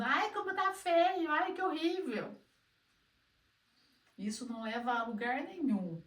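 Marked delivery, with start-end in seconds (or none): 0.0-2.4 high, whiny mock complaint; mock whining. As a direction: whine it like a self-pitying student, pitched high. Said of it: "Sem ficar chorando e falando". (choramingo fingido, agudo e exagerado)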